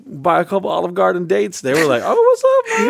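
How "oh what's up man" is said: high-pitched, hyped-up "what's up"; squealed mock-friendly hello; mock eager greeting. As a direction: imitate someone suddenly very friendly. Imitating the eager Olive Garden dates.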